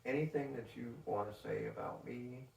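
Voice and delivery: ordinary delivery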